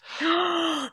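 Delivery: sharp, high mock gasp; horrified gasp. Imagining being the one nobody claps, horrified gasp. Reacts to nobody clapping her.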